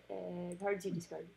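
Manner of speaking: ordinary delivery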